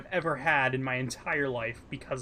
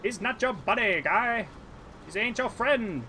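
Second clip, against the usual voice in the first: mock Canadian accent, higher pitched; 8 semitones higher than usual. Arguing with friend about the voice, mock Canadian accent. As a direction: ham up a South Park Canadian. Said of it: "No, no, it's a Canadian voice".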